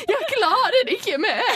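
mock tearful wail. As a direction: ham up a breakdown. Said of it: "begynte du å skrike".

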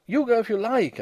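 refuse, still somewhat frantic. A narrator's reading. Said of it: "a little less frantic for his laughter".

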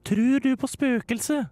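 put-on high, slow girl voice; Hilde's voice. Hilde suddenly asks Stian about ghosts, high and slow. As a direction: ask slowly in a high timid voice. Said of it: "spurte plutselig Hilde".

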